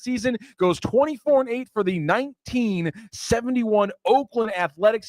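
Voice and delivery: high-pitched, excited winner announcement; game-show announcer. Real, not acted. Host revealing the trivia winner and answer, in high announcer voice.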